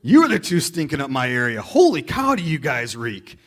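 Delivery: loud, high "Whoa!" then an exaggerated gripe; hammed-up grossed-out waiter; mock disgusted waiter. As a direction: play a waiter recoiling from the smell. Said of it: "Waiter comes over and goes".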